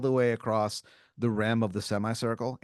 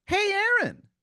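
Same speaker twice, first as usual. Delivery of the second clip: very high-pitched, louder cartoon voice; cartoon pickle calling out; 5 dB louder than usual. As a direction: startle him with a squeaky, high "hey". Pickle character suddenly calls out.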